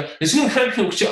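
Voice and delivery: hurried, trying to keep up; breathless rushed imitation; rushed copy. Shown as a demonstration. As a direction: chase the recording and stumble. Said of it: "哎呀，跟不上".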